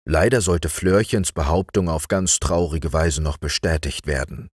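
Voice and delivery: ordinary delivery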